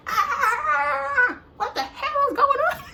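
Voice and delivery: very high, loud comic shriek; hammed-up high-pitched yell; mock screaming. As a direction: shriek it in exaggerated panic, half laughing. Acts herself screaming while being punched.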